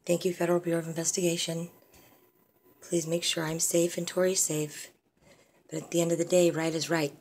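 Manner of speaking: ordinary talk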